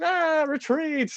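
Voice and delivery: very high, drawn-out panicked cry; mock panic. Spanish guards fleeing the pissing kid, high mock panic. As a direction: imitate soldiers fleeing in comic terror. Guards flee in retold story.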